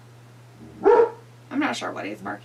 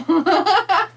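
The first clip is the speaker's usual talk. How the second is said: loud laugh; 9 semitones higher, 9 dB louder than usual. Real, not acted. Laughing at wrestling fan line.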